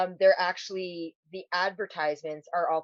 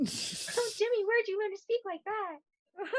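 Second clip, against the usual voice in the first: put-on much higher, softer swooning girl's voice; 13 semitones higher, 5 dB quieter than usual. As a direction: gush like a charmed girl. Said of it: "Fancy talk".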